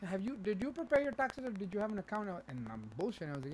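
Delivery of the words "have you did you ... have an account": high, pressing agent questions; scam caller impression. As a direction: quiz like a phony tax agent. Acts out the scam woman.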